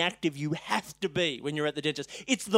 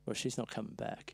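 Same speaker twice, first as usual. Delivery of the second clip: a hushed, much softer aside; said quietly; 10 semitones lower, 15 dB quieter than usual. Retold or imitated. Other assistant quietly tells dentist she left, hushed. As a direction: drop it low and soft, barely there. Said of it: "said quietly".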